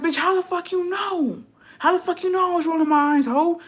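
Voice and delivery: high-pitched, incredulous challenge; indignant comeback. Retold or imitated. Acted comeback to mother.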